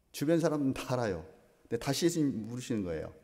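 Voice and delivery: usual speaking voice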